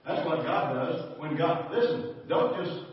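loud, high, urgent preacher; impassioned preaching, rising in pitch and volume; full-force preaching. Real, not acted. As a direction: drive it home to the congregation, building to a peak.

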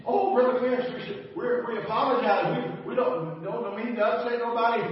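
imitating a worked-up church lady, high and loud; loud, high-pitched retold apology; retold flustered outburst. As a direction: blurt it out as the overwhelmed woman. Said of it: "She, she just screamed".

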